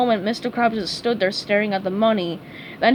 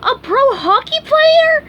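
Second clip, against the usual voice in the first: much higher and louder, slow astonished squeal; can't believe his luck; stunned, slow high squeal; breathless amazement; 8 dB louder than usual. A narrator's reading. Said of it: "SpongeBob gasped".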